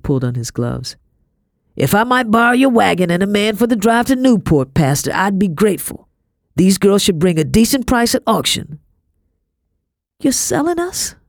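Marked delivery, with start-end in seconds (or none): 1.8-8.8 higher, firmly projected gentleman; businesslike slave master. A narrator's reading. Master asking pastor for wagon to auction, businesslike. 10.2-11.2 very high, startled question; blurted shock. A narrator's reading. Girl learning of the auction, blurted shock. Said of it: "The words flew out of my mouth".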